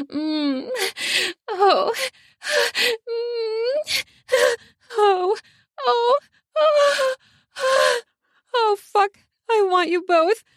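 high breathy moans building to words; breathy moaning. Acted. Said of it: "she said in a breathy voice".

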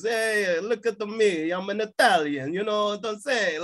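a cartoon Italian accent, showing off; mock Italian accent. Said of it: "everyone's sort of doing, like, this".

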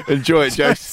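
laughing. Real, not acted. Laughs at farewell joke.

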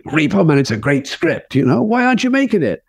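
high, needling challenge; pushy manager. Retold or imitated. Manager pressing Ramey about the rejected script, high and needling. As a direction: press him hard in public. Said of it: "the manager".

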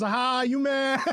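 mocking high taunt. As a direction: needle him with a smug jeer. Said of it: "He goes".

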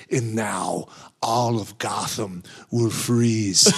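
slow, low villain menace; slow theatrical villain menace. Retold or imitated. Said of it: "doing, like, a villain voice".

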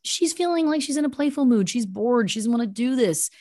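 usual speaking voice

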